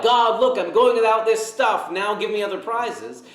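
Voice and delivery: high-pitched bid for a reward; mock demanding. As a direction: show off your sacrifice to claim a prize. Acted bargaining with God for prizes.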